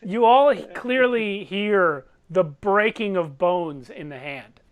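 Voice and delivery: ordinary talk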